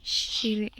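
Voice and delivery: ordinary talk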